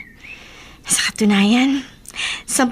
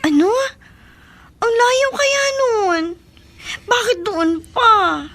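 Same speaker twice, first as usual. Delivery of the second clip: slow, higher tearful protest with a cry in it; 10 semitones higher, slower than usual. Acted. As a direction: plead through tears, drawn out. Hears boyfriend leaving for Bicol.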